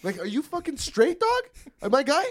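high-pitched mock concern; talking to wasted homie. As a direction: check on a messed-up buddy, incredulous. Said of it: "talk to him like I talk to".

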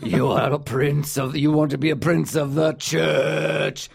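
low, slow intense imitation; mock Jonathan Rhys Meyers close-up delivery; hammed-up dramatic low voice; imitating brooding actor. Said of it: "he talks like this".

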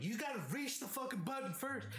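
high scornful shout; mocking comeback. Mocking small roommate's threat, high scornful. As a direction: taunt his size.

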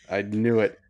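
ordinary delivery

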